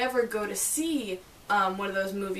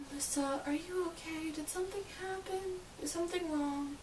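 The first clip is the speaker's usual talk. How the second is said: higher, softer worried voice imitating friends; concerned friends asking; 8 semitones higher, 10 dB quieter than usual. Friends checking on a teary Melissa, soft and high with concern. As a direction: gently ask if she's okay, hushed and high. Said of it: "everyone will be asking me".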